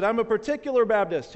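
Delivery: high, loud mock yell; acted shout from below. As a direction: holler it up to someone above. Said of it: "And he yelled up".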